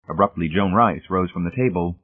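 usual speaking voice

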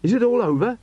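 high, loud, anxious query; urgent question. A narrator's reading. Watchman arriving at the fire, asking urgently. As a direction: call it out on arrival.

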